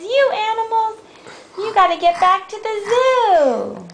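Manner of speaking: high-pitched storybook scolding; scolding zookeeper's wife. Acted. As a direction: order them off in a high voice. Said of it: "She says".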